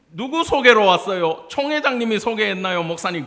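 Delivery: high, loud mock interrogation; imitating a blunt questioner; loud nosy questioning. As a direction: fire it off as a prying question. Mocking nosy questions put to pastors.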